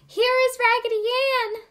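high, loud joyful cry; happy shout. Acted. Marcella spotting her lost doll in the tree, joyful shout. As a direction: call it out with delight. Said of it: "she shouted".

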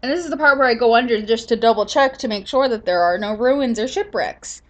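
ordinary talk

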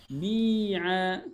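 بيعا said slowly with both vowels held long; drawn-out model form. Shown as a demonstration. Teacher models the dual command, drawn-out بيعا. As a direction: stretch the ee and aa of بيعا.

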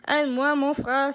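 loud, pleading call. A narrator's reading. Mufasa on the cliff begs his brother, loud and pleading. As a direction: beg your brother loudly for help. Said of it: "supplia Mufasa".

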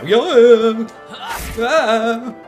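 loud, high drawn-out combat cries; battle yells. Real, not acted. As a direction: yell with each sword swing. Battle cries while fighting monsters.

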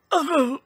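loud high yelp of shock; startled yell. Acted. Benny sees the strange dinosaur, yelling in alarm. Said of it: "he yelled".

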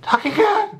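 very high-pitched startled squeal; squeaky, hammed-up panic; mock freak-out. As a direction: imitate a girl freaking out. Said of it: "she freaked out when Luna talked to her".

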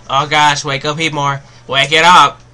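loud, desperate urging; urgent pleading shout. Real, not acted. Player yelling at his sleeping Heatmor, loud and urgent. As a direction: yell at the screen for it to wake up.

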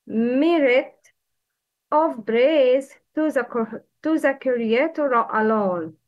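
usual speaking voice

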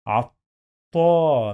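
عطا very slowly, "at... taaa"; long alif vowel. Shown as a demonstration. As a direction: hold the final vowel of عطا long and loud. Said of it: "مد بالألف".

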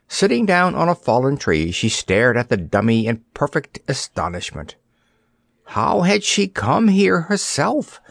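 ordinary delivery

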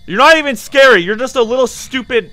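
loud, high angry taunts; yelling at the ghost. Real, not acted. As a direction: shout insults at a ghost. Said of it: "yelling at the ghost".

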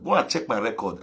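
quiet knowing echo. Real, not acted. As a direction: drop it to a soft aside.

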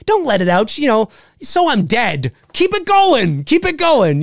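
a hint of dad cheerfully urging the guys on; imitating dad. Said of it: "He'd be like".

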